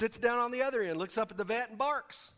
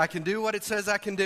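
quick call for the echo; 4 dB louder, faster than usual. Shown as a demonstration. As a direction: call it out fast and firm. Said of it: "Repeat after me and say".

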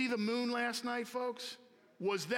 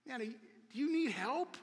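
lightly voiced puzzled dad, pitched higher; a hint of a worried father's question; concerned dad; 5 semitones higher than usual. Dad on the phone to his odd son, lightly voiced concern. Imagined dad baffled by son's plea.

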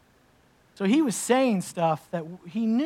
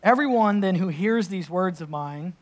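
scripture reading; 6 dB louder than usual. Acted. Rereads the Scripture passage.

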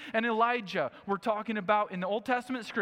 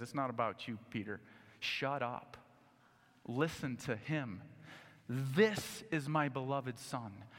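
much lower and softer, slow; a hushed God correcting Peter; quiet divine rebuke; 6 semitones lower, 7 dB quieter than usual. Acted. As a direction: say it low and final. God interrupting Peter again.

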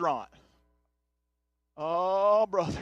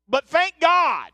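scripture reading; 4 semitones higher than usual. Acted. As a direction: lift the words up as holy text. Reads Paul's letter aloud.

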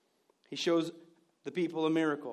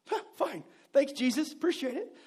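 sarcastic thanks; 6 semitones higher than usual. Retold or imitated. Disciples voiced, tired of crowds.

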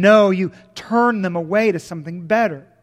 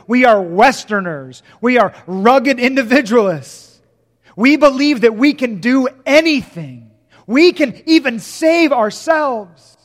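louder, rising preacher's call to the crowd; rousing pulpit delivery, louder than the talk around it; full-force preaching; 3 semitones higher, 6 dB louder than usual. Acted. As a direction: preach it out hard. Preacher declaims to congregation.

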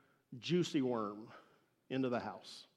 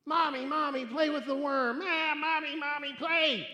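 put-on high, loud whiny boy voice; whining tantrum; 10 semitones higher, 5 dB louder than usual. Boy hollering at his mom to play with the worm, whining. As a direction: wail like a spoiled brat. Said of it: "screaming and crying and hollering".

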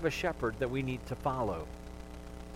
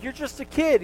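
scoffing soldiers; 10 semitones higher, 4 dB louder than usual. Retold or imitated. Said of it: "they're like".

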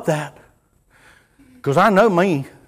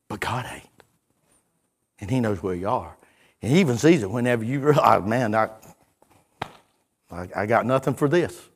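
tender hush; 7 semitones lower, 7 dB quieter than usual. Acted. Preacher softens to tender hush.

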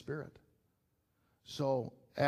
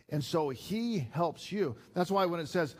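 fiery pulpit preaching; full-force preaching; 5 semitones higher, 5 dB louder than usual. Acted. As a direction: preach it out, building to a peak.

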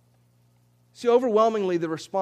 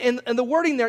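ordinary talk, nothing marked

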